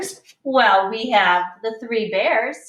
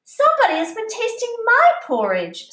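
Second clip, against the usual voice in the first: much higher mama bear voice; mother bear, higher and fussy; middle-sized bear voice; 12 semitones higher than usual. Acted. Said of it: "in her middle-sized voice".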